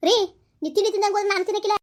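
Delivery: wife's high, loud plea to her husband; pleading protest. Acted. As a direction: call after him before he leaves. Wife pleads after husband storms.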